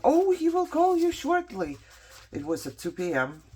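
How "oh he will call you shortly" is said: high, sing-song fake-polite voice; mocking receptionist. Patient mocking the GI's receptionist, high and fake-sweet. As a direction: mimic a breezy receptionist brushing her off. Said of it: "She's like".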